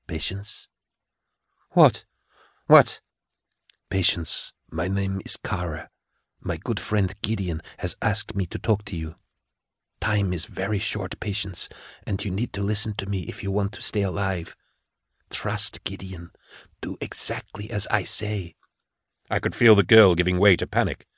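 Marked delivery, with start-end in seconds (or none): 0.0-0.7 much softer, gentle reassuring voice. A narrator's reading. Kara speaking softly into Patience's mind to calm her. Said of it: "Kara Swole's soft, reassuring tones". 1.7-3.0 higher startled what. Acted. Patience startled by a new voice. 3.9-18.5 much softer, gentle reassuring voice. A narrator's reading. Kara speaking softly into Patience's mind to calm her. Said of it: "Kara Swole's soft, reassuring tones".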